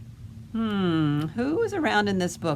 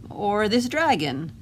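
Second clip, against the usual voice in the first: usual speaking voice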